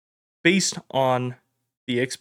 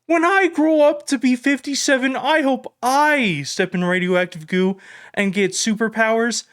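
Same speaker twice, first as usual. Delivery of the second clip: put-on excited kid; 9 semitones higher than usual. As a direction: gush like a kid about Superman. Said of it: "they're like".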